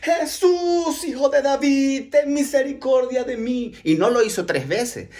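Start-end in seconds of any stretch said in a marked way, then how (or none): 0.0-3.7 high, long-held plea for mercy; urgent beggar's shout. Acted. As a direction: cry out to be heard over the crowd. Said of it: "Dice que dio voces".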